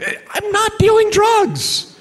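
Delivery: very high, loud squeaky protest, drawn out; voice going up. Retold or imitated. Speaker denying drug dealing to a grand jury, voice going up. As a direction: protest your innocence with the voice shooting way up. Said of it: "my voice goes up".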